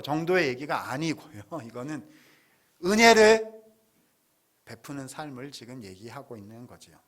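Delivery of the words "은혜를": 은혜를 cried out high and loud; preacher's loud cry on 은혜를; emphatic shout. Real, not acted. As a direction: burst out on 은혜를 with full force. Preacher stresses key word.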